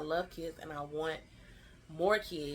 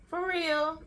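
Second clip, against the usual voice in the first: high, loud retold gasp of disbelief; surprised mom; 11 semitones higher, 7 dB louder than usual. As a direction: mom caught off guard, pitched up. Mom hears pregnancy news.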